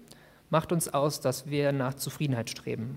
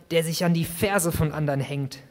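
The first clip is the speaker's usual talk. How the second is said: ordinary delivery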